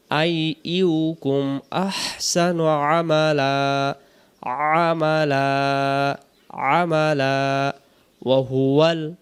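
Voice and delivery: slow, drawn-out tajweed chant; Quran recitation. Shown as a demonstration. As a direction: recite it like a qari, very slowly. Recites the verse. (ধীর তেলাওয়াতের সুরে)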